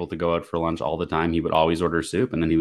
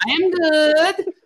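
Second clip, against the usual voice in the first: high, loud, slow mock punchy answer; mock aggressive reply; 5 dB louder, much slower than usual. Caregiver answering Ryan on speakerphone, high loud mock intensity. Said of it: "very aggressive".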